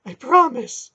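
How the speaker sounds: high, frightened stammer. Acted. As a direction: stutter the promise in terror. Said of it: "he stammered".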